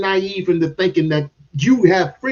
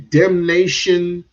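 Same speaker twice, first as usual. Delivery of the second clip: usual speaking voice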